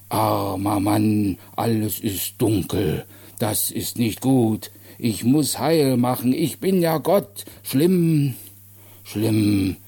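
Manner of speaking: slow, heavy pity rising to insistence; booming simple-minded man. Acted. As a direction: lament ponderously, stretching the words. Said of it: "sein dröhnender Bass". (schwerfällig klagend, langsam)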